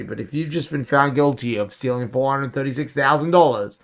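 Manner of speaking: normal talking voice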